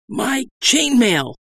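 slow, high wheeze; wheezing. A narrator's reading. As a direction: choke the words out. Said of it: "I wheezed".

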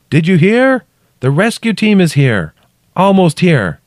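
high, loud shouted news; calling down. A narrator's reading. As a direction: shout down that help is near.

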